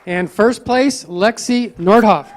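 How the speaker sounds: loud, slow award announcement; announcing winners. Real, not acted. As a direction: call each name out to the room. Announcing spelling bee winners.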